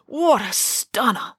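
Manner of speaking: slow, high, drawn-out admiration; smitten exclamation. Acted. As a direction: linger on it, awestruck. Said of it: "exclaimed Binky".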